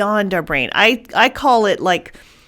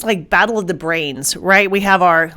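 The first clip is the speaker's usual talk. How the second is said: usual speaking voice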